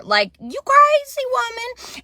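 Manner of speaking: playfully imitating him calling her crazy; mock husband voice. Husband's reaction quoted in story.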